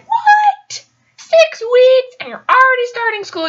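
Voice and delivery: high, loud astonished questions; acted-out amazement; shocked disbelief. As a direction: react as if you can't believe it. Reacting in disbelief to his news.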